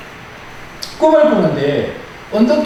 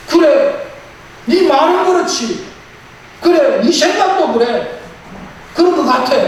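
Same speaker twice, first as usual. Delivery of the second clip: high, tense back-and-forth voice; agitated dream argument; 11 semitones higher than usual. Acted. Booth confronting his own double in the dream, agitated. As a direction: argue with yourself in disbelief.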